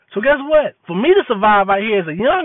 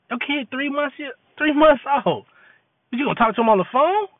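mock incredulous scolding; 7 semitones higher than usual. Mocking a dad on the phone.

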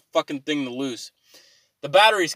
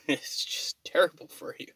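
whisper; 6 dB quieter than usual. Real, not acted. Whispered aside.

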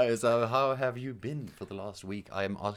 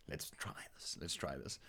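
whispering; 7 semitones lower, 17 dB quieter than usual. Pretend. Drops voice before bit.

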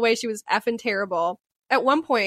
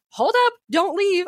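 high, slow, drawn-out halt; retold alarm; 9 semitones higher, 4 dB louder than usual. Homeowner stopping mom leaving after seeing a snake, mock alarm. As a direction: stop them urgently, stretching the words.

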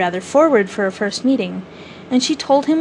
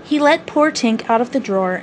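ordinary delivery

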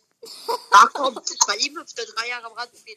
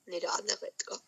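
whisper; 3 semitones lower, 12 dB quieter than usual. Real, not acted. Aside while gaming.

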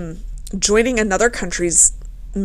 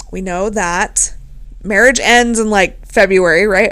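ordinary talk

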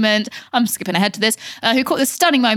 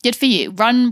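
ordinary delivery

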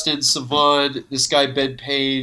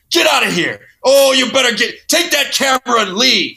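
high, loud mock old-man yell; angry old man; 10 semitones higher, 5 dB louder than usual. Imitating old guys kicking them out, angry shout. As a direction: shout like an outraged old guy. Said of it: "these old guys be like".

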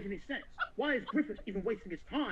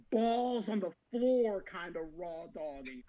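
ordinary talk

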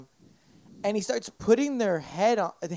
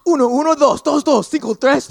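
loud, high Spanish babble; mock blathering; 5 semitones higher, 8 dB louder than usual. Imitating the pastor praying over people, loud mock blathering. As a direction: rattle off Spanish nonsense like a radio announcer. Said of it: "he starts fucking blathering some shit".

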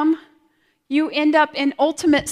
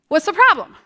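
higher, louder exasperated question; exasperated grandma; 7 semitones higher, 7 dB louder, faster than usual. Retold or imitated. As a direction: toss it off like an impatient grandmother. Grandmother dismissing her distress.

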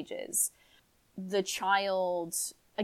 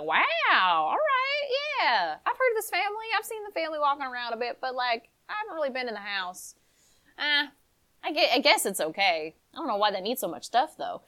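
higher, breezy casual chatter; chatty young woman; 7 semitones higher, faster than usual. Acted. Amber eyeing the family's house, higher chatty voice. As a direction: rattle on like a curious, offhand visitor.

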